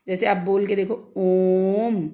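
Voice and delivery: ओम held long, three beats of vowel; drawn-out ओम. Shown as a demonstration. Teacher showing students a long ओम, held for three beats. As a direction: stretch ओम out like a chant. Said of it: "तो कितना लंबा time खींचा हमने".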